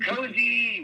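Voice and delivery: high, loud reveal of the name; excited announcement. Retold or imitated. Father telling family the name, bright and excited. Naming the daughter's blanket.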